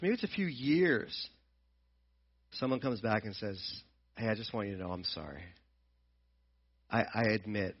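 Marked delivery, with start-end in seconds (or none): 4.2-7.7 much softer, quick humble apology. Retold or imitated. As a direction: apologize quietly, head down. Someone returning to apologize.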